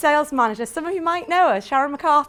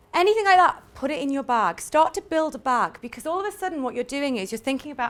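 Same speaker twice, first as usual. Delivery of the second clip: normal talking voice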